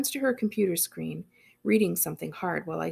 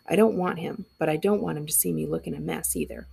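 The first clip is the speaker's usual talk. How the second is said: usual speaking voice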